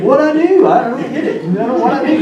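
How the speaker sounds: high, mock-innocent little brother; innocent younger sibling. Preacher voicing David playing innocent to his big brother. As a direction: play dumb like a kid brother caught out. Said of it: "typical younger sibling".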